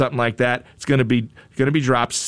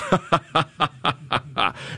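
a short falling chuckle, a bit softer; real laugh; 4 dB quieter than usual. Real, not acted. Host laughing at drone dropping package into pool, short chuckle.

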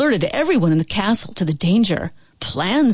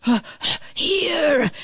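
hissing; 8 semitones higher than usual. A narrator's reading. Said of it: "hissed the dragon".

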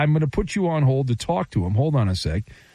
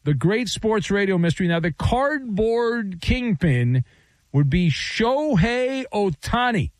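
higher, slow showy segment intro; radio announcer read; 7 semitones higher, slower than usual. Acted. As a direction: sell it like a big reveal. Host reads segment like announcer.

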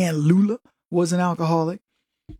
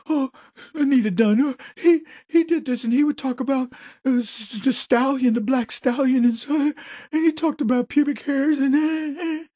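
higher, flustered mock accuser; 6 semitones higher than usual. Host mocks an accuser at a hearing, pitched up and flustered. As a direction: babble it up high and rattled. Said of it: "Nothing verifiable".

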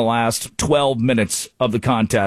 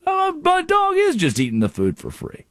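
mock realization; 11 semitones higher than usual. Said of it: "I thought".

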